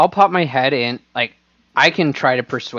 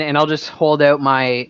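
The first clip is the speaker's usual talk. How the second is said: usual speaking voice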